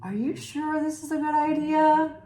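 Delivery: anxious porcupine, voice up high; nervous porcupine. Acted. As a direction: fret as the careful friend. Nervous Porcupine before sneaking in.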